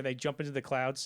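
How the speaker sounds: ordinary talk, nothing marked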